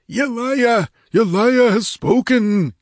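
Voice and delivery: very high, loud, slow fanatical proclamation; exalted cry. A narrator's reading. Priest proclaiming the goddess's word to his followers, exalted. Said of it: "he cried in an exalted voice".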